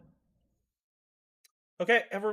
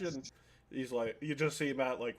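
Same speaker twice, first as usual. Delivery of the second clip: usual speaking voice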